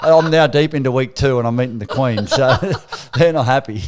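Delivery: loud, laughing confession. Real, not acted. Player laughing about teammates' lost pay, loud and amused.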